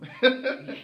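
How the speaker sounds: high laugh. Real, not acted. Laughs at mother's reply.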